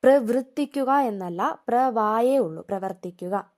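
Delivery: ordinary talk, nothing marked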